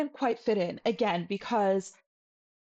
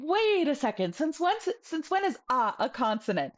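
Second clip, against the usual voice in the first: mock objection, pitched higher; imagined puzzled learner; 6 semitones higher than usual. Teacher voices what learners might be thinking, mock-incredulous. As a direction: voice a student's sudden disbelief, playfully. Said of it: "you might be thinking".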